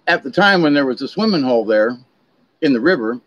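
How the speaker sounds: ordinary talk, nothing marked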